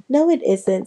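ordinary delivery

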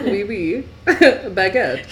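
laughing, hammed-up French warm-up; French accent. Shown as a demonstration. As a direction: find the French accent again, giggling. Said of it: "I lost my French accent".

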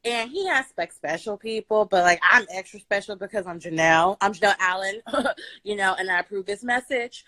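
ordinary delivery

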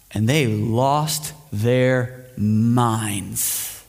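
very slow, drawn-out for effect; dramatic slow emphasis. Real, not acted. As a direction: stretch each word dramatically.

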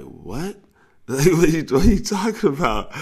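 high, loud incredulous cry through a laugh; laughing disbelief. Retold or imitated. Kids hearing girls are coming over, laughing in shock. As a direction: shriek it in delighted shock.

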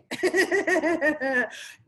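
laughing at her own joke; a big real laugh, pitch jumping up; bright burst of laughter. Real, not acted. Laughing at her own crackers joke.

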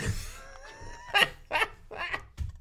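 very high wheezing laugh; high squealing laugh. Real, not acted. Laughing at smart garden.

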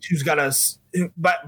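usual speaking voice

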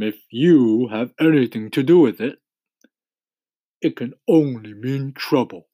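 gloomy grumble, not hushed; muttering earthworm. A narrator's reading. Earthworm doubting the centipede's journey, gloomy grumble. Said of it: "muttered the earthworm".

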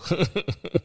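high burst of laughter. Real, not acted. Laughs at pole memory.

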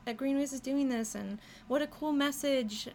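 high-pitched imitation of an admiring passerby; so excited. Passerby admiring the trail mural, so excited. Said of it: "just be so excited about it".

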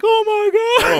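loud, very high scream; screaming awake. Retold or imitated. Husband retells waking up from a nightmare, screaming. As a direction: re-enact a terrified scream, full voice. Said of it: "I woke up screaming, like".

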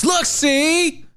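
smug flash of a badge. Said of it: "Just be like".

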